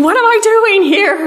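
high, loud despairing question; doubting cry. Retold or imitated. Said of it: "doubting and wondering".

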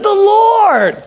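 loud, high, drawn-out proclamation; triumphant exclamation. Retold or imitated. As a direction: belt it out as the climax. Pastor's climactic confirmation.

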